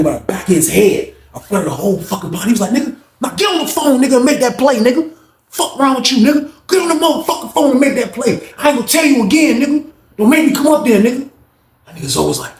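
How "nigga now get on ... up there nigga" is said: high, forceful orders; voicing an inmate ordering, high and forceful; commanding threat. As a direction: order him to make the call. Reggie ordering phone call.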